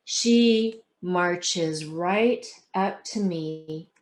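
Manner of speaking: expressive read-aloud storytelling; story narration. A narrator's reading. Author reading her book to the audience, expressive. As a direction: tell it like reading to a class.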